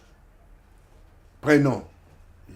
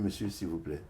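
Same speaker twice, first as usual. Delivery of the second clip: softer, near-tearful plea; quiet pleading; 3 semitones lower, 12 dB quieter than usual. Retold or imitated. Young man pleading with the second clerk, soft and near tears. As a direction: ask for help softly, almost crying.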